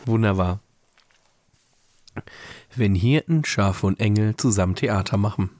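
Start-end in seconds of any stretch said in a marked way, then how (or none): 2.7-5.5 measured read-aloud storytelling voice; calm narrator, lower than in chat; story narration. A narrator's reading. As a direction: read the Christmas story aloud, pausing between sentences. Reads a Christmas story aloud.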